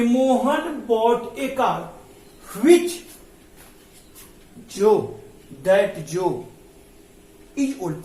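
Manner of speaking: very slow, word by word, as if writing on the board; slow board-writing dictation. Shown as a demonstration. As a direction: spell out Mohan bought a car slowly for the class. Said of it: "क्या बना".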